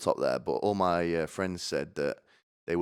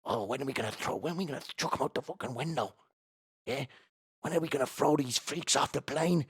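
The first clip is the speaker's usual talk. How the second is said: higher-pitched mock grumbling; impatient snooty passenger; 6 semitones higher than usual. Seated passenger wanting standing ones thrown out, impatient mock moan. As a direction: complain eagerly, itching to be rid of them. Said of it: "quite happily to not feed 'em".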